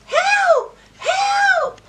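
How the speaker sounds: high, loud cry for help; duck yelling for help. Acted. Duck stuck in the muck calls for help, loud high shout.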